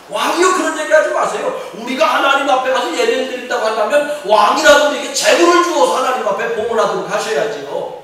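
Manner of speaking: high, emphatic protest to a king; Moses protesting to Pharaoh. Acted. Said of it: "무슨 얘기냐?". (왕에게 항의하듯 높고 힘주어)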